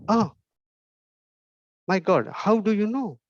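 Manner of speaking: a hammed-up gasp of wonder; mock amazement. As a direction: play up being amazed he guessed. Balloon man amazed at the guess.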